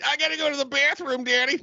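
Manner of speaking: put-on high whiny child; whiny kid voice. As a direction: beg like a little kid. Acted kid in back seat.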